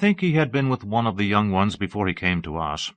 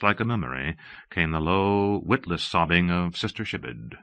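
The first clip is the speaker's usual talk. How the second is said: ordinary delivery, nothing marked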